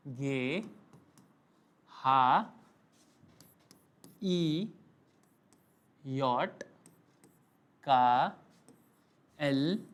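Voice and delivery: each letter spoken alone with a pause to repeat; slow teacher's drill, one letter at a time; German letters called out for the class to echo; German alphabet recital. Shown as a demonstration. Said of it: "आपको मेरे पीछे पीछे repeat करने के लिए".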